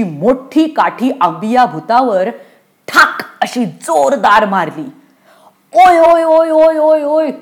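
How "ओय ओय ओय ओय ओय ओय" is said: loud, rapid string of high ows; high pained yelping. Acted. Ghost yelps after the girl whacks it, high pained cries. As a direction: yelp in pain on one high note, easing off. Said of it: "अंबिया भूत कळवळलं".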